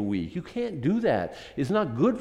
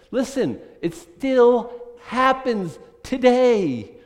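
emphatic; 8 semitones higher, 6 dB louder, much slower than usual. Real, not acted. Preacher stresses his point.

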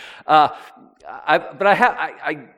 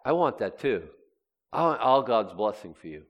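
ordinary talk, nothing marked

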